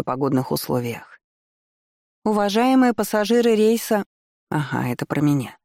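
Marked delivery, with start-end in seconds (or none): 2.2-4.0 detached announcer voice, higher; flat PA announcement read; airport announcement. A narrator's reading. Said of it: "всё тот же бесстрастный женский голос".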